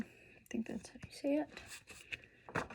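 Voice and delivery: soft unsure whisper to herself; half to herself, a breathy aside. Real, not acted. Reader whispers to herself about the illustrator's name, hushed.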